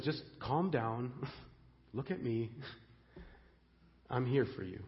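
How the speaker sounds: calming tenderness. Acted. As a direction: soothe a worried listener. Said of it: "And he just says".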